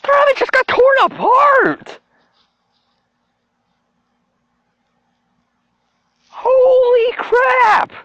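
very high, drawn-out screech; stretched, squealing shock; horrified shrieking. Real, not acted. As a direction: wail it in disbelief at something gory. Horrified reaction to gory gif.

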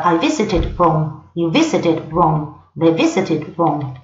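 slow, careful past tense read; slow English demo. Shown as a demonstration. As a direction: spell it out slowly for learners. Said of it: "En pasado en inglés se dice siempre igual".

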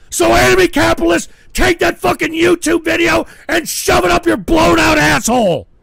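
yelling in a rage at the chat; real furious outburst; furious shout. Real, not acted. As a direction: scream abuse at your critics. Ranting at chat and enemies.

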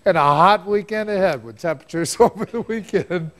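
breaking into giggles mid-forecast; words fighting back laughter; laughing through words. Real, not acted.

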